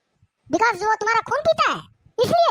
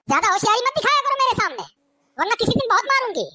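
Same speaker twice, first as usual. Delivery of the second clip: ordinary talk, nothing marked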